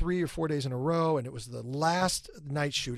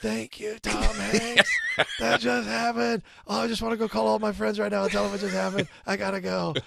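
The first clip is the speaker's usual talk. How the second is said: starstruck gushing; 6 semitones higher, 3 dB louder than usual. Retold or imitated. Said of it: "I was like".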